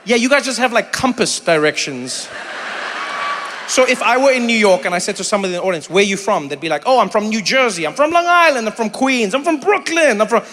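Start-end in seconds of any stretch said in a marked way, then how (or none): none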